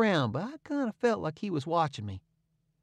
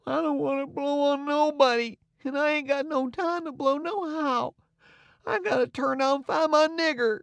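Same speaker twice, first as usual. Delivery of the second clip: put-on much higher, thin whimpering boy; 12 semitones higher than usual. A narrator's reading. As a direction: whine it like a scared child. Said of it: "I went on a whimpering".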